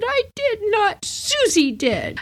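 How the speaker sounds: put-on very high, insistent little-girl denial; whiny little girl. Little girl insists to her mom that Susie did it. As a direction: deny it hard like a stubborn kid. Child denies.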